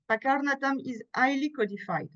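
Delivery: normal talking voice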